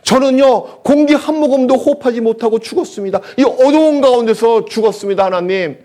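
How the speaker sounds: acted plea building from hushed to high and loud; aborted baby crying out. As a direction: cry out a wronged child's accusation to God, rising in pitch. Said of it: "나타나서 이렇게 외칠 겁니다". (원통함을 하나님께 점점 높여 외치는 태아 연기)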